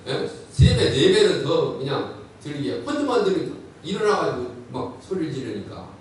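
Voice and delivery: usual speaking voice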